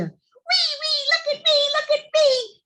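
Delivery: put-on high, thrilled child voice; gleeful squeal. Boy on the swing showing off to his sister, high and thrilled.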